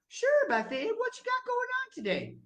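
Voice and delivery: high, bright friendly God; lightly voiced cheerful God; God's voice. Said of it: "And he could say".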